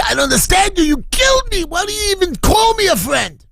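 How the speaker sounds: very high, accusing scream; high-pitched retold confrontation; distraught shouting. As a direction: cry out in anguished bewilderment. Survivor confronts man he accuses.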